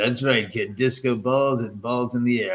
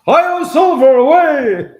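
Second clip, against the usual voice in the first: high, loud heroic cry of the Lone Ranger; loud heroic rallying call; 13 dB louder than usual. Retold or imitated. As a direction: belt it out like a cowboy hero on a horse. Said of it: "you gave me the line".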